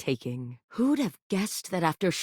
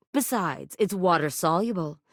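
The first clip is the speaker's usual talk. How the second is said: ordinary delivery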